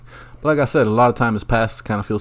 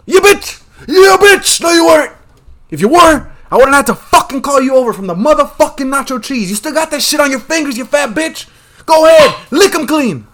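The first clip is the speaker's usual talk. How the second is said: screaming much higher and much louder; furious yell; 9 dB louder than usual. Retold or imitated. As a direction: blow up at the cashier at full volume. Retold yelling at cashier.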